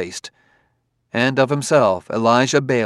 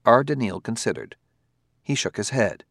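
usual speaking voice

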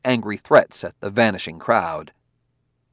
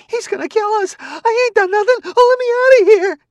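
high, loud, panicked wail; sobbing yell; 10 dB louder than usual. A narrator's reading. Frightened woman yelling at the robot. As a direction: scream to be let out, near tears. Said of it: "In a sudden whirlpool of sobbing, she yelled".

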